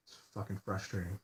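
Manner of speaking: muttered under breath. Real, not acted. Alone in bed on camera, repeating it in a soft defeated mutter.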